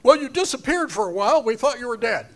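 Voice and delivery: mock excuse. People's excuse to Moses.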